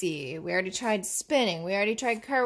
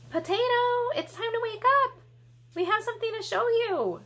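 much higher, a bit louder wake-up call; sing-song wake-up call; 12 semitones higher, 3 dB louder, faster than usual. Acted. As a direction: coax a sleepy friend awake brightly. Waking up the puppet.

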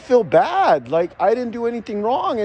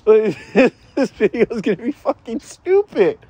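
words broken up by laughter; laughing while talking; 4 dB louder than usual. Real, not acted. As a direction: laugh through the line at your own video. Laughing at his own video.

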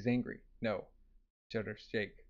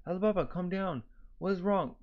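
raised, higher pitch, urgent; alarmed plea; 6 semitones higher than usual. Acted. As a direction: plead with him to calm down. Character alarmed at Alibaba going berserk.

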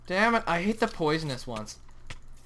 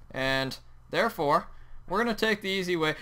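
ordinary talk, nothing marked

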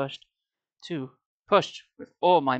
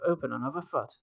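usual speaking voice

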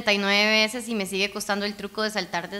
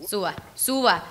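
normal talking voice

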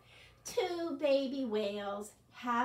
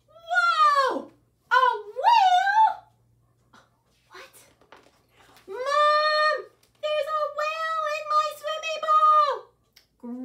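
put-on much higher and much louder, a startled boy; shocked shout; 14 dB louder, slower than usual. Little boy shouting to Mom about the whale, much higher and louder. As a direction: yell for Mom in disbelief.

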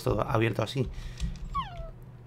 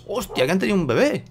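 surprised; 7 semitones higher, 4 dB louder than usual. Real, not acted. Baby dogs appear unexpectedly.

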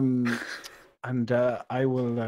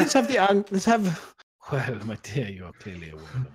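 lightly voiced Viking accent; 3 semitones higher, 6 dB louder than usual. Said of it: "accents is tough".

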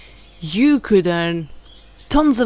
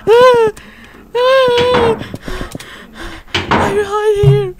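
panicked; 11 semitones higher, 5 dB louder than usual. Real, not acted. Panicking while looking to hide.

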